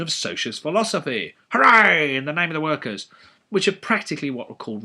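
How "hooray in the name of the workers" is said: loud, high sarcastic hooray; mock cheer. Lecturer mocking socialist policy, loud sarcastic cheer. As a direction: cheer with heavy irony.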